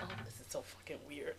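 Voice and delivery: whispered through a nervous laugh; overwhelmed whisper. Real, not acted. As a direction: breathe it out, embarrassed by the emotion. Overwhelmed by the emotional exercise.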